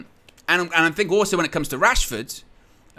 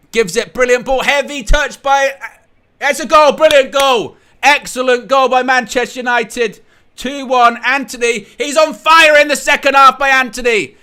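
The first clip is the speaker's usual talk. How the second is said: yelling the goal, high and loud; real goal celebration shout, much higher and louder; excited goal shout; 9 semitones higher, 9 dB louder than usual. Real, not acted. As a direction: scream it like the ball just hit the net. Live goal call while watching.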